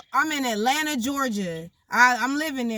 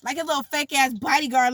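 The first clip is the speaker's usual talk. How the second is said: normal talking voice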